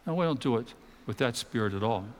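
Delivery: usual speaking voice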